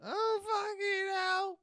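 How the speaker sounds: hammed-up sobbing lament; slow comic crying; mock wailing. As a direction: wail it, self-pitying. Wife wailing over botched surgery.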